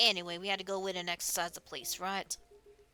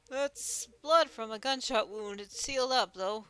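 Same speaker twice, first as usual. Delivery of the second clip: Dooley; 3 semitones higher, 6 dB louder than usual. Acted. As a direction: insist slowly and loudly in character. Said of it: "another guy make up another voice".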